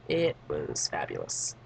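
slow, low, soft deadpan sarcasm; dry, sunken-pitch irony; sarcastic. Real, not acted. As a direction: say it drawn out and very low, meaning the opposite. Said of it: "Not really".

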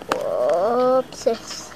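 ordinary talk

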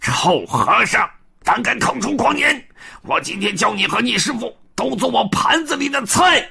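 high furious demon roar. Acted. Demon king enraged at Pigsy's threat, high angry roar. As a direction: rage at the monks. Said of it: "那妖怪一听大怒".

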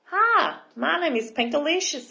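high, eager hello; bright greeting. Acted. Girl introducing herself to the mermini, eager greeting. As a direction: start high and perky, then settle.

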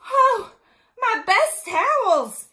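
high, very loud storybook wail; slow, stretched cry of dismay; dismayed outcry. A narrator's reading. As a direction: cry out over ruined treasures. Missus Rogers finds her ruined towels.

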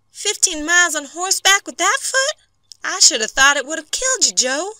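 high-pitched, astonished woman's voice; shocked wife. A narrator's reading. Wife to husband who rode in injured, shocked. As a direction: exclaim it high, in disbelief.

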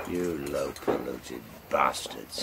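slow, drawn-out snarl. Acted. Beaten captain cursing the gang member, a slow snarl. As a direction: spit the insult out slowly. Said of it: "snarled the captain of the push".

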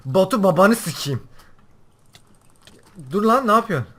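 angry cursing. Real, not acted. Player curses the attacker Batu, angry shout. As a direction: swear loudly at an opponent.

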